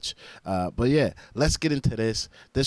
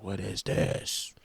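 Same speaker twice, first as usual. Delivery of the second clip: slow, drawn-out disbelief; retold amazement; much slower than usual. Host recalls hearing the studio drums, slow amazement. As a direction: stretch out each word as if stunned.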